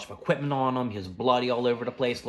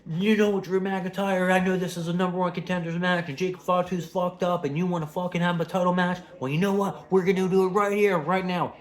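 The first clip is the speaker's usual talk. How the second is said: angry challenge; 8 semitones higher, 4 dB louder than usual. Retold or imitated. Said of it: "He was pissed off".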